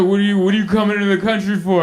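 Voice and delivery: fast, brisk border agent questioning; customs agent. Retold or imitated. Comic retelling the border agent grilling him, fast questions. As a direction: rattle it off like a bored customs officer.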